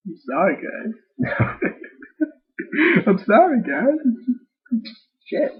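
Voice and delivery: high laughing through the apology. Real, not acted. Reader apologizing to viewers, laughing high. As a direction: giggle helplessly while saying sorry.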